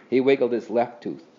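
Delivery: plain reading voice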